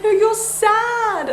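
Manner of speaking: put-on high, drawn-out child's insistence; Layla. Daughter correcting her crying mum, insistent child voice. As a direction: imitate a small child stubbornly contradicting, very slow. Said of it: "She's like".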